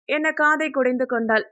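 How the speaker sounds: ordinary delivery, nothing marked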